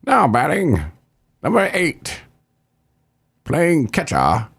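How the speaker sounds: high put-on announcer voice; Vin Scully. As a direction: announce the next batter with long pauses. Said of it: "voice of the Dodgers".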